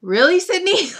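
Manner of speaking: high, loud, incredulous question; mock exasperation. Reacting to friend screaming into pillow, mock exasperated. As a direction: call her out in exaggerated disbelief.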